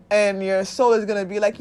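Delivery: ordinary delivery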